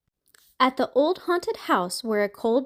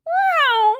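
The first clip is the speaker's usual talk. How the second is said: very high, loud meow; kittens meowing; 6 dB louder than usual. A narrator's reading. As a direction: cry it like tiny kittens. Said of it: "cried the three".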